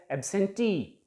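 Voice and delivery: "absentee" with TEE lifted and lengthened; stress on last syllable. Shown as a demonstration. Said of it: "टी को हम थोड़ा सा ऊंचा उठाकर".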